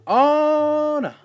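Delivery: dismayed cry. Real, not acted. Player about to be eaten.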